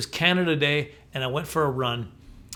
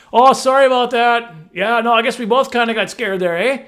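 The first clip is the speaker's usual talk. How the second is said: loud, higher, hammed-up friendly apology; apologetic Canadian; 8 semitones higher, 10 dB louder than usual. Canadian runner calling sorry to a bear, comically apologetic. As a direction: call out a neighbourly sorry, playfully.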